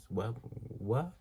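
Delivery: ordinary delivery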